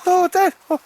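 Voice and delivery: very high, loud squeaky pup voice; dog's voice. Pretend. Owner voicing his dog Baldrick to Al, high squeaky dog voice. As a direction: speak for the dog in a squeaky falsetto. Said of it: "He says".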